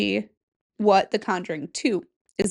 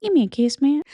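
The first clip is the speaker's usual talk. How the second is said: higher, cooing creepy doll; 6 semitones higher, 4 dB louder than usual. Pretend. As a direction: tease as the haunted doll. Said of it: "Annabelle's like".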